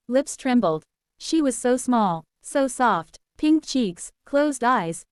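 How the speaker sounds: ordinary delivery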